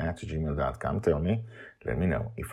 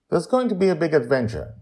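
lightly voiced girl; 14 semitones higher, 8 dB louder, faster than usual. Joy voiced, child character.

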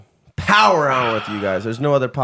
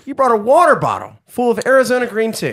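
incredulous ribbing; 8 semitones higher than usual. Real, not acted. Mocking friend's chaser.

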